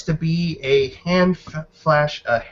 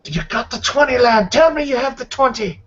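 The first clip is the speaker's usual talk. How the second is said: high-pitched, insistent gambler demanding an answer; urgent demanding gambler; 11 semitones higher, faster than usual. Acted. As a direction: press the lad urgently, pitched way up. Demanding character voiced by game master.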